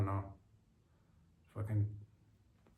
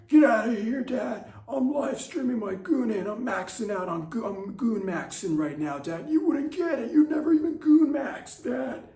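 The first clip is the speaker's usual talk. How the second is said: put-on high, loud bratty teen yelling at dad; shrill-pitched brat shooing his father away; whiny teen son; 11 semitones higher, 7 dB louder than usual. Son yells through doors.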